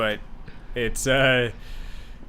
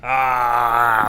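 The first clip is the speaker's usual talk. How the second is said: zombie impression. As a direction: groan like a hungry zombie. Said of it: "your best zombie impression".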